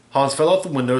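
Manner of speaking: plain reading voice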